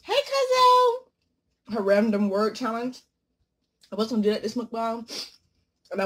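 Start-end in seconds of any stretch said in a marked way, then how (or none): 0.0-1.8 high, very slow, loud called greeting; stretched high hello; catchphrase impression. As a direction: sing out the greeting like the YouTuber. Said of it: "Rhonda red sauce".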